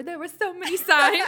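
high and loud, cracking up; laughing mock whine. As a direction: push it up high through laughter. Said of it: "he's like".

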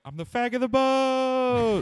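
high, slow mock shout; hammed-up high-pitched jest. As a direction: squeak it out as a joke. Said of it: "and I went".

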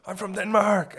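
high, loud put-on voice; Danish player voice. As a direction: announce it in a silly high voice. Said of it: "He's like".

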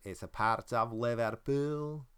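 put-on slow, trying on a Liverpool accent on Liverpool; attempted Liverpool accent. Host imitating his cohost's Liverpool accent, slowly. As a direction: reach for a parody Liverpudlian sound, drawn out. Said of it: "Eh, getting there".

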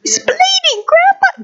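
put-on very high-pitched little kid voice; whining grandchild. Grandchild protests to grandpa about bleeding, high child voice. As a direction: squeal a protest like a small child. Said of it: "My grandpa".

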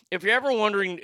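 ordinary talk

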